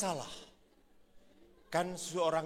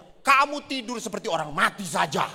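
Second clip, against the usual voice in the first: louder, scornful wife voice; mock grumbling wife; 3 semitones higher, 7 dB louder than usual. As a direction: complain he sleeps like a corpse. Wife complaining again. (istri mengeluh, lebih keras)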